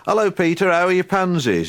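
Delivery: high, loud teasing villager voice; sing-song neighbourly jibe; mocking villagers. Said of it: "people would say".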